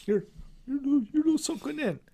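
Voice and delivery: high swallowed you're so in; mock the mumbled voice; teasing friend imitates a mumbler; mumbling impression. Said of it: "you don't say it with your chest".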